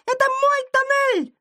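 very high, tiny indignant voice; thin little shout. A narrator's reading. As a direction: squeal at intruders to clear out. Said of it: "кричал кто-то тоненьким голоском". (возмущённый крик тоненьким голоском)

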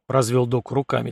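ordinary delivery

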